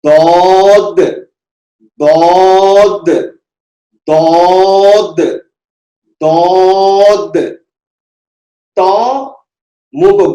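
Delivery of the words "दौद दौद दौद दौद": दौद loud and slow, rounded lips; heavy dwad drill. Shown as a demonstration. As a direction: repeat दौद heavy with the tongue side on the molars. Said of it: "दौद देखिए".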